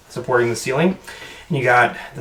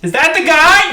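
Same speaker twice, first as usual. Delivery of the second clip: loud high shout; 12 dB louder than usual. Acted. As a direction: blurt it out loudly. GM voices Billy loudly.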